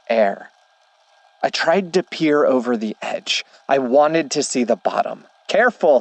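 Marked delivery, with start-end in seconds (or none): 5.5-6.0 higher, mildly raised warning; shouted warning. A narrator's reading. Boy at the hole warns the narrator, mild shout. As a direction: call out a sudden caution, understated shout. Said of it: "he shouted".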